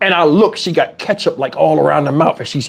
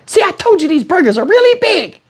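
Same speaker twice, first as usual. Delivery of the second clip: put-on high, smug little-girl voice; 13 semitones higher than usual. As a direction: play the kid gloating. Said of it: "She's like".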